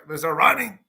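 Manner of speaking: ordinary talk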